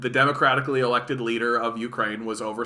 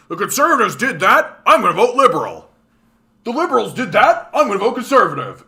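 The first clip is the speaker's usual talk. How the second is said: higher, louder and fast, mock clueless voter; dumb Canadian voter; 7 semitones higher, 9 dB louder than usual. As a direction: ham up a flip-flopping voter stuck in a loop. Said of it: "Canadians be like".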